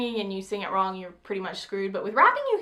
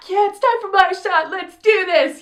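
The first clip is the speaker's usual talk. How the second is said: loud, high retold audience hype; energized excitement; 9 semitones higher, 8 dB louder than usual. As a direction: burst out like a pumped-up fan. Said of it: "everybody has this energy in the audience".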